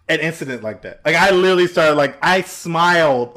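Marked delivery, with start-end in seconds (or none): none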